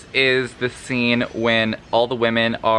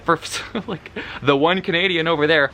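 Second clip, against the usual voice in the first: higher, quick words through a laugh; real amused laugh breaking in; 5 semitones higher, faster than usual. Real, not acted. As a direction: repeat it while cracking up. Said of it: "made me laugh so hard".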